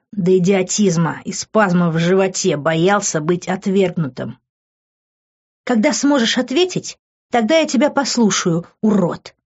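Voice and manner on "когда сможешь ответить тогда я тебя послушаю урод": higher, annoyed female character; hissing. A narrator's reading. Girl pulls her hands from his, hissing lightly. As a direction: throw it at him in a higher voice. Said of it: "прошипела она".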